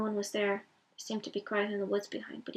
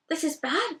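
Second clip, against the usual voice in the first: blurted in alarm, higher and louder; alarmed cry; 6 semitones higher, 6 dB louder, slower than usual. Acted. Sam leaps up, alarmed at Boromir's news, crying out. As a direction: jump up and cry out. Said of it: "cried Sam, jumping up".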